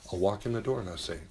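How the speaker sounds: normal talking voice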